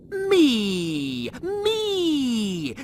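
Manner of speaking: high, loud triumphant cries; gleeful crowing. Acted. Rimmer jigging with glee at being chosen. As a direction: crow it with delight. Rimmer celebrating, doing a jig.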